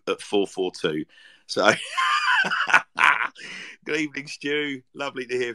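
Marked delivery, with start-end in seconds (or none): 1.8-3.8 burst of laughter. Real, not acted. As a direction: crack up with a piercing squeal. Bursts out laughing at coffee story.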